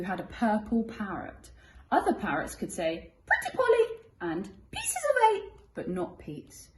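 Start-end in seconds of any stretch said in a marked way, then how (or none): 3.3-4.0 high squawky parrot. Acted. Parrots' catchphrase voiced. 4.7-5.5 high squawky parrot. Acted. Parrots' catchphrase voiced.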